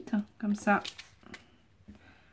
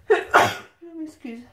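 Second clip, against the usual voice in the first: after sneezing; 8 semitones higher, 9 dB louder, much slower than usual. Real, not acted. Apology right after sneezing.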